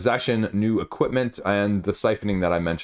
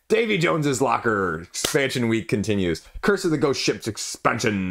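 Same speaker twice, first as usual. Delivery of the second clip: a light pirate accent, hammed up for fun; playful mock pirate accent. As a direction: announce it like a pirate captain, lightly. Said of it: "I don't know why I went with that accent".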